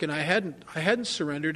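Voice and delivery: normal talking voice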